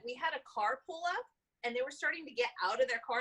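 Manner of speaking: ordinary delivery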